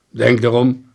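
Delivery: loud, firm warning; detective's loud caution; stern warning. A narrator's reading. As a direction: warn the suspect firmly. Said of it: "gaf hij als waarschuwing mee".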